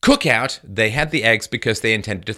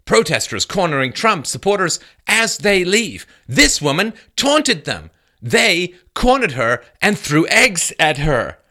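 quoting reporter; 7 semitones higher, 4 dB louder than usual. Retold or imitated. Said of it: "Sarah Murray said".

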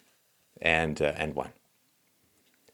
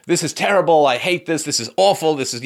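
raised, exasperated grumbling; higher-pitched hypothetical griping; mock complaint; 6 semitones higher, 4 dB louder than usual. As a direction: act out a frustrated rant. Acting out an ordinary player's complaint.

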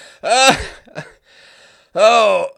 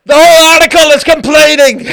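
loud, incredulous, laughing; exasperated exclamation; 8 semitones higher, 16 dB louder than usual. Real, not acted. Host mocking the article, loud exasperation. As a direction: shout it in disbelief.

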